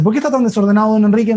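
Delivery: mildly indignant telling-off, as retold; retold angry nurse. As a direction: imitate a cross nurse reprimanding a patient. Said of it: "Bien enojada".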